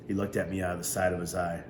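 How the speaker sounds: plain reading voice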